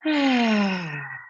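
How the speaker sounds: demonstration sigh. Shown as a demonstration. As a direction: breathe out slowly and audibly to show it. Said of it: "T, take a breath".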